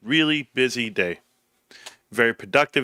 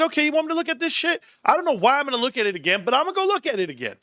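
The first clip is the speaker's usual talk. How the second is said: much higher, fast, fed-up self-quote; mock exasperated self; faster than usual. Retelling his reaction to the Epstein chatter, high and fast. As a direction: throw up your hands, whining high and quick. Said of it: "I said".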